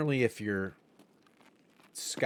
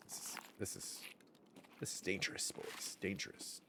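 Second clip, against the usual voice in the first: tense whisper; 2 semitones lower, 14 dB quieter than usual. Real, not acted. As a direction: breathe it so nobody hears. Enemy behind him, whispering.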